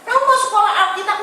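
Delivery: coaxing father. Retold or imitated. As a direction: push the option quickly and hopefully. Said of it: "dia ngomong gitu".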